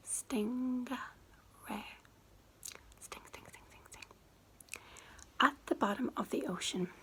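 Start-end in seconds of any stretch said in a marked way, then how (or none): 3.0-4.0 each angelfish softer than the last; fading whispered echo. Pretend. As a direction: breathe the word away three times.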